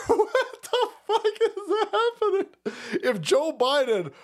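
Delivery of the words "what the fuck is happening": high, slow words squeezed through laughter; helpless laughing disbelief. Real, not acted. Host reacting to absurd clip, helplessly laughing. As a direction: barely get it out for laughing.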